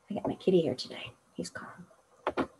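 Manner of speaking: ordinary delivery, nothing marked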